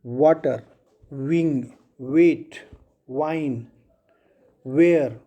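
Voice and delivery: rounded-lip W starting वाटर, विंग, वेट, वाइन, वेयर; W sound. Shown as a demonstration. Teacher gives W examples from वाटर to वेयर, lips rounded. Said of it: "डब्ल्यू का व sound करते हैं तो उसमें होंठ आपका गोल हो जाता है".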